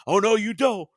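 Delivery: high, loud cartoon yell; high yelled "Oh, no, you don't"; yelled quote. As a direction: shout it out like a cartoon kid guarding his toy. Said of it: "I just yelled out".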